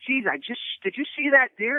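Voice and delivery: eager, breathless asking; a brother blurting out his excitement; excited question. Retold or imitated. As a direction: pitch climbs high on "did you see that deer". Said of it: "And I go".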